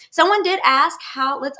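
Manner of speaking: normal talking voice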